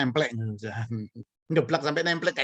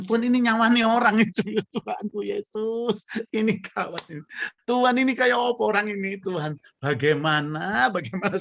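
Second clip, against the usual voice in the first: raised, pleading cry to God; a retold plea, pitched up and urgent; retold anxious prayer; 7 semitones higher, 4 dB louder than usual. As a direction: beg God for help, voice lifted high. Preacher retells his anxious night prayer.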